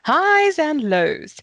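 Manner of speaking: slow sing-song pitch exercise; highs and lows. Shown as a demonstration. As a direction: swoop high on highs, drop on lows. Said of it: "we practice pitch exercises".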